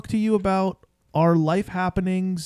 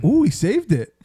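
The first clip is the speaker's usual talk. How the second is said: mildly impressed, pitched-up ooh; retold moment of pleasant surprise; light, admiring exclamation; 4 semitones higher than usual. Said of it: "I was kind of impressed".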